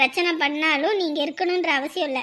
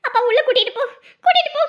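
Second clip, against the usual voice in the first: urgent order; 5 semitones higher, 5 dB louder, faster than usual. Acted. Mother urgently orders son.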